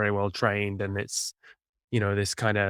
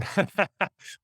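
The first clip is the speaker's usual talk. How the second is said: real laugh; 9 semitones higher than usual. Real, not acted. Host laughs at long answer.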